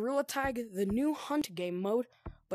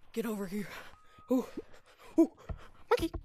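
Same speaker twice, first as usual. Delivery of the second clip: ordinary delivery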